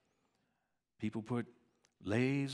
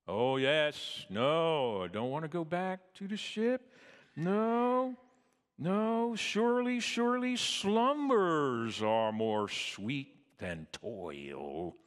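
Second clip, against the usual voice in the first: pleading lotus eater; 6 semitones higher, 7 dB louder, slower than usual. Retold or imitated.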